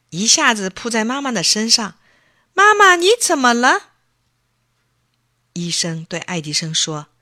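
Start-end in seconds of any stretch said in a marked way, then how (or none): 2.5-3.9 put-on high, loud startled boy's cry; worried child. Boy rushing to his sick mother, high and alarmed. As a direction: throw yourself at her in alarm.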